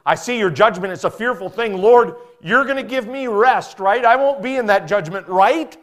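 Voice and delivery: high, loud pleading prayer; anxious prophet crying out; crying out to God. Retold or imitated. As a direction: beg God for reassurance, voice climbing. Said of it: "He's crying out and saying".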